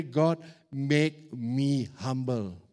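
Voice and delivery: slow, drawn-out mock prayer; a lightly hammed-up plea to God. Preacher mocking the wrong prayer, slow mock pleading. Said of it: "Don't pray".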